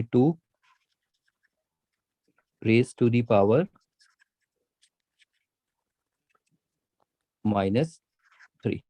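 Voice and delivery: slow, spaced-out reading of the power; teacher showing how to say it slowly; slow model reading. Shown as a demonstration. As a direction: drag each word out for students to copy. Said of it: "ऐसे ही बोलना है".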